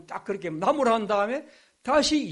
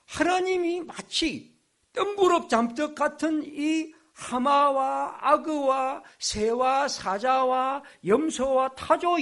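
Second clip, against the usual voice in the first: slow emphatic listing; 7 semitones higher, 3 dB louder, slower than usual. Real, not acted. Preacher lists God's odd topics.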